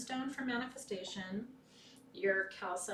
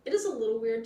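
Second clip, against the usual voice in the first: high, loud, slow laughing delivery; 13 dB louder, slower than usual. Real, not acted. Amused at the chick in the egg, laughing high and loud. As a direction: laugh through the words.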